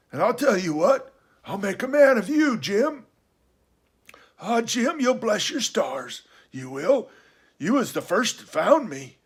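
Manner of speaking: high, loud castaway, eagerly promising; excitable sea-dog, pitched well up; Ben Gunn. Acted. Castaway promising the boy a fortune, high and eager.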